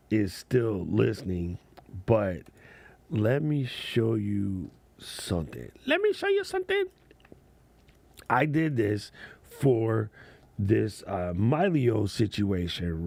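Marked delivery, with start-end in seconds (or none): 5.9-6.9 very high, fast cartoon squeak; high chipmunk voice, quick; squeaky high voice. Shown as a demonstration. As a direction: jump way up into a tiny squeaky voice. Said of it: "repeats in a put-on voice".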